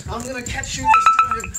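loud teasing threat to the dog; playful chase. Real, not acted. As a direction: growl it out as a playful game.

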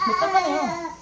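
words muffled by chewing; talking while chewing. Real, not acted. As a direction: mouth partly full of food. Seen 1: Faces camera, chopsticks in hand, cheeks and lips show chewing while talking.

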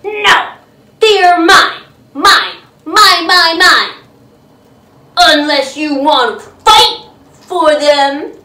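loud possessive yelling; guard the food, shouting; grouchy shout. Acted. Grouchy ladybug claims the aphids.

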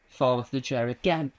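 ordinary talk

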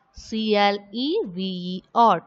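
letter by letter, evenly spaced; spelling out letters. Shown as a demonstration. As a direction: spell each letter out separately.

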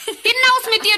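high, loud laughing jeer; laughing taunt. Acted. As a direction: cackle the order out. Stepsisters mock Cinderella.

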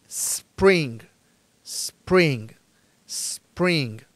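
each "spring" opened by a long snake hiss. Shown as a demonstration. Teacher drilling learners, "spring" repeated with a pure initial S. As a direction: hiss the S, then "pring", for every "spring". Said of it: "este sonido de la serpiente".

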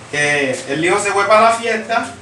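normal talking voice

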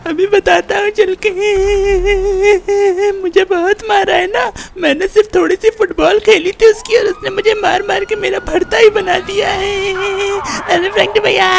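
hammed-up, high tearful whine; crying. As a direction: wail out the complaint, trailing long sobs after phrases. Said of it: "इतना रो क्यों रहा है".

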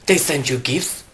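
ordinary talk, nothing marked

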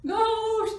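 very high, very loud scream. A narrator's reading. Friends seeing a ghost behind the door, screaming. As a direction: scream in storybook terror. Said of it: "they screamed".

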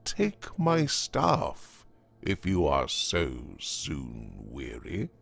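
low, quiet companion, urging onward; lightly voiced elder, softly coaxing; worldly elder traveller. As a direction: coax a weary fellow along, quietly.

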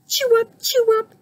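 very high, peppy chirp; chirping cricket. A narrator's reading. Cricket popping up to join the band, high chirp. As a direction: chirp it in a tiny squeaky voice. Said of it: "he chirped".

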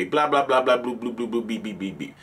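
droning blahs fading into beeps; narcissistic robot. Acted. Mocking the narcissist's routine, robotic babble dying away. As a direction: trail down like a robot running its script. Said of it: "The robot, the narcissistic robot".

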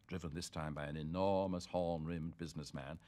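ordinary delivery

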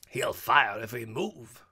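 loud, slow, drawn-out jeer; mocking cry. A narrator's reading. Girl points at the poker, crying out in mockery. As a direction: cry it out to tease him. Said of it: "she cried".

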